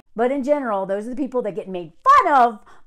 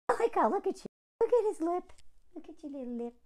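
cooing at dog; 5 semitones higher, 10 dB quieter, much faster than usual. Real, not acted. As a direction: coo quietly over a sleeping pet. Cooing over the sleeping dog.

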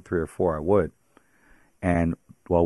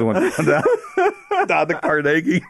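laughing through the words; 10 semitones higher, 5 dB louder, much slower than usual. Real, not acted. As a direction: laugh it out, high and loud. Sheepish retelling, laughing.

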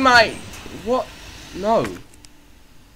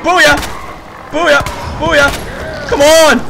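triumphant shouts; 5 semitones higher, slower than usual. Real, not acted. Gamer landing shots on the boss, shouting. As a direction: fire off each cry like a hit. Shooting at game enemy.

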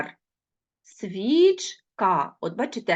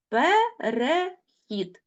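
very slow, each syllable spaced apart; 5 semitones higher, much slower than usual. Shown as a demonstration. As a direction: spell out Безпідставний slowly by syllables. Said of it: "teacher splits word into syllables". (повільно, по складах)

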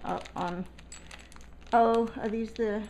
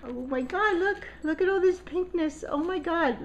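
high, gushing delight; thrilled squeal over the pink; excited; 8 semitones higher than usual. Real, not acted. Unboxing her swap gift, gushing higher.

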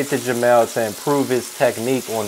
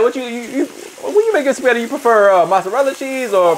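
mock host voice; 11 semitones higher than usual. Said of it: "mock interviewer question".

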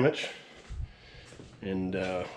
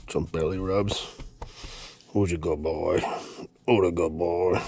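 lower, cooing to the dog; dog talk; 4 semitones lower than usual. Real, not acted. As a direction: praise the dog gently. Petting his dog.

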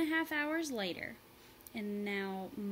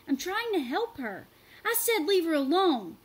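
loud, higher back-and-forth; shouted argument; 7 semitones higher, 9 dB louder than usual. A narrator's reading. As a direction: argue over the noise. Shouted quarrel over stick.

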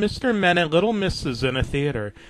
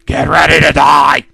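demonic voice; 10 dB louder than usual. Acted. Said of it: "he said in a demonic voice".

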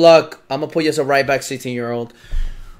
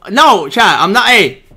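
louder, pleading protest; 2 semitones higher, 6 dB louder than usual. Real, not acted. Streamer protesting loudly to his friend Chad. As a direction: insist you're not joking.

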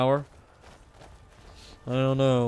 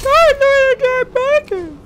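high, loud squeaky outrage; 13 dB louder than usual. Pretend. Gamer voicing the dragon, squeaky cry. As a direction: squeal it in a cartoon voice.

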